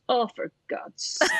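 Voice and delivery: slow, breathy whispered grumble; imitating mum muttering in the corner; exasperated mum. Mum under the confetti, slow exasperated whispered mutter. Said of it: "she just goes".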